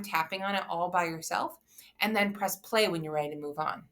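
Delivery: ordinary delivery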